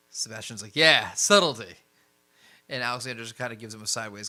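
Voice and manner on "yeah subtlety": loud, emphatic agreement; punchy, raised-voice echo; boisterous echo. Acted. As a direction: chime in heartily on "subtlety". Sebastian chimes in, echoing the rebuke.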